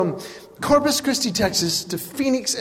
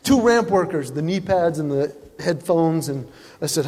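ordinary talk, nothing marked

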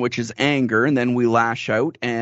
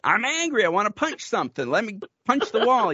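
higher-pitched, hammed-up angry outburst; mock anger; 9 semitones higher than usual. Husband pressed about his feelings, mock-angry and high-pitched. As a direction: act out losing patience under pressing. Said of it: "pressing me more and more".

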